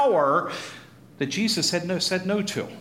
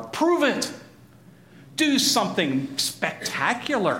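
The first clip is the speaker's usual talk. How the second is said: raised, insistent challenge; demanding dare; 5 semitones higher, slower than usual. Acted. Townspeople demand a miracle from Jesus, challenging. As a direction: dare him to show what he can do. Crowd daring Jesus to prove himself.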